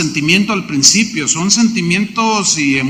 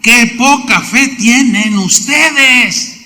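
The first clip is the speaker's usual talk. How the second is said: slow rebuke; 5 semitones higher, slower than usual. Retold or imitated. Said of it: "algo muy tremendo".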